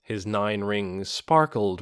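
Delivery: ordinary delivery, nothing marked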